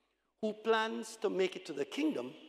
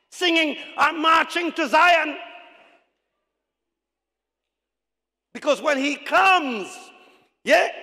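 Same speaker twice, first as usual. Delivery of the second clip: loud, high, shouted sermon; full-force preaching; 9 semitones higher, 11 dB louder than usual. Acted. Preacher urging church to let the Spirit take control, full-force preaching. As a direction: belt it out to the congregation.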